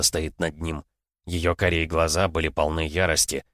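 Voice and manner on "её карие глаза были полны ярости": ordinary delivery, nothing marked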